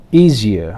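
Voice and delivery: "easier" with EA stressed, the rest dropping low; first-syllable stress. Shown as a demonstration. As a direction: drill "easier", weight up front, falling tune. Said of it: "put stress on the first syllable". ("easier" के पहले syllable पर ज़ोर)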